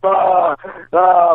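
high, drawn-out "aah"; imitated meathead scream. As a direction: act out overly aggressive lifting screams. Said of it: "like, screaming".

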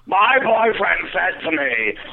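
high, slow, screechy impression of the friend; annoying screaming friend. Friend announcing her boyfriend's rule in the car, annoying screamed voice. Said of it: "she screams like that?".

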